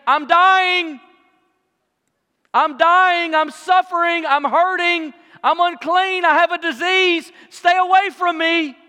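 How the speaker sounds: high, loud repeated cries of warning; loud proclaiming. Acted. Leper forced to announce the disease daily, loud proclaiming. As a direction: shout each phrase out to keep people back. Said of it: "have to get up and say".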